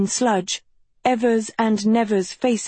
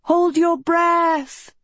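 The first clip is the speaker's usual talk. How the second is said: high, slow shouted warning; urgent cry; 9 semitones higher, much slower than usual. A narrator's reading. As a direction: cry out as you fall. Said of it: "Agatha cried".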